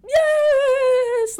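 long, high, loud wail; sobbing scream. Retold or imitated. Crying girl answering the concerned clown, high sobbing scream. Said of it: "Like, sobbing".

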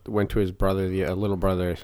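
usual speaking voice

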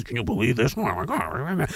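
soft muttered mock grumbling; mock grumble. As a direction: grumble it under your breath, mocking. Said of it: "grumbling amongst themselves".

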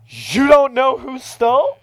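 high, loud, drawn-out accusing question; mock accusation. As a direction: imitate an incredulous accuser, slowly. Woman accusing him, retold.

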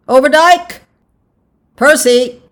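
high, loud roll call. A narrator's reading. Official calls the next man forward, loud. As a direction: call the name across a crowd.

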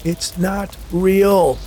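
high, slow, drawn-out shout. A narrator's reading. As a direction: shout it, stretching each word. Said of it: "Hank shouted".

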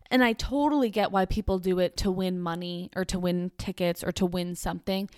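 ordinary talk, nothing marked

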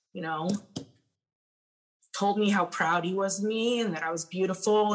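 normal talking voice

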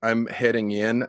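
ordinary talk, nothing marked

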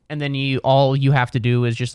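ordinary talk

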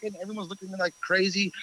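normal talking voice

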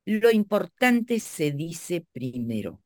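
slow, weighty maxim; slow and deliberate. Shown as a demonstration. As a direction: lay it down word by word. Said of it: "método infalible".

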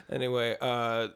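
ordinary talk

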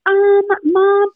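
put-on high, hesitant kid calling mom; a hint of a nervous daughter on the phone; worried daughter voice. Daughter calling mom to report a fight, high and hesitant. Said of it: "Ada calls me and she's like".

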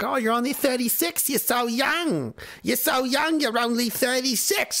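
high, cooing mock voice; mocking well-wisher. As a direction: gush it in a silly high parody of reassurance. Mocking people who call him young.

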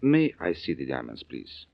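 put-on French accent, polite. Frenchman asking the fence to see the diamonds, French accent. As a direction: ask like a courteous French buyer. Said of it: "Gonna try out your French accent?".

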